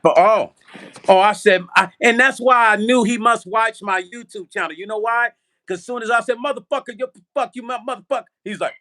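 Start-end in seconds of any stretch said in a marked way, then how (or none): 0.0-4.7 excited realization. Real, not acted. 6.4-8.2 higher, heated mock cussing; retold cussing out. Narrator retelling cussing out the scammer, higher and heated. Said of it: "when I went the fuck off on you".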